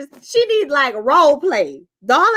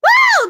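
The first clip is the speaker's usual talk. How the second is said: sharp loud high whoop; piercing loud whoop; high shrieking woo; 6 dB louder than usual. Real, not acted. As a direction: let out a fed-up whoop. Exclamation after rant.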